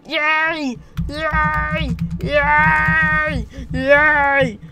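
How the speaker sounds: loud, high, drawn-out cheers; excited cheering. Real, not acted. Cheering a find in the game.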